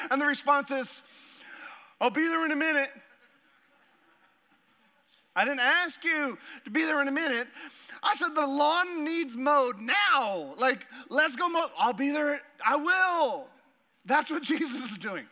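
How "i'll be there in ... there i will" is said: raised, higher voice in both roles; kid and parent quarrel. Retold or imitated. Parent demanding lawn mowed, kid stalling, voiced louder.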